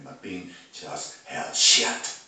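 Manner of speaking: ordinary delivery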